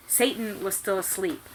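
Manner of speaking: ordinary delivery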